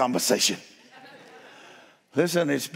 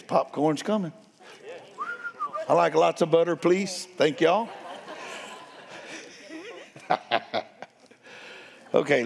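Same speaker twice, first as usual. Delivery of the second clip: ordinary delivery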